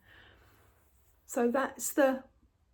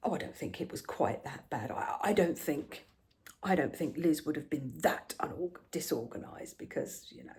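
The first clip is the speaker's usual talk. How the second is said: lower, doubting husband, fading to a hush; a hint of a sceptical husband; 6 semitones lower, 5 dB quieter than usual. Mimicking her husband doubting her story, lower. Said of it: "He went".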